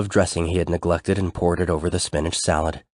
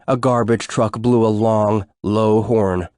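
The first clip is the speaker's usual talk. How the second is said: usual speaking voice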